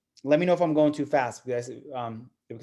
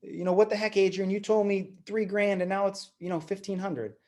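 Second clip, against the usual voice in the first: higher-pitched mock dismay; imitated shocked complaint, pitched up; shocked seller; 7 semitones higher than usual. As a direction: act out a client feeling misled. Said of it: "you never want your seller to be shocked".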